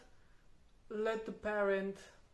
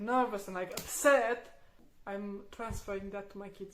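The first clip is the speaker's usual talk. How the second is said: ordinary delivery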